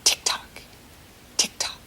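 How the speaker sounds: soft unvoiced tick-tock; whispered clock sound. Shown as a demonstration. Teacher ticks softly with the kids, whispered. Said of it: "You try".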